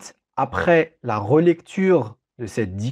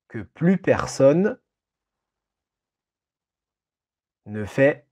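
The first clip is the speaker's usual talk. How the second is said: dictating. Shown as a demonstration. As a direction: read it out for a dictation. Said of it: "Je répète cette phrase, cette longue phrase".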